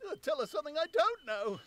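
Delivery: high, pained tramp retort; pained old tramp. Acted. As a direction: snap back through the pain. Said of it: "gasping with pain".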